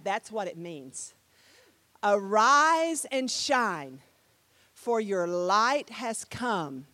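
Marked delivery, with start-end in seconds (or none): 2.0-6.9 loud, very slow proclamation; drawn-out Bible recital; scripture declamation. Shown as a demonstration. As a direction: stretch each word with weight. Proclaims the verse after explaining it.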